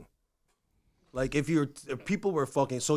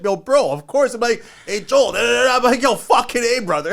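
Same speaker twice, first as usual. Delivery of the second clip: lightly voiced construction bro; slightly higher and louder buddy talk; 9 semitones higher, 5 dB louder than usual.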